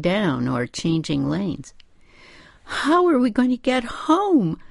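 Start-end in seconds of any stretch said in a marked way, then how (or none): 2.6-4.6 put-on high, lost, childlike question; helpless childlike voice. A narrator's reading. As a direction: ask it like a lost child, pitched way up. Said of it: "Her voice sounded lost and childlike".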